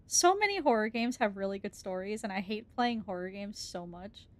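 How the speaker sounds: ordinary talk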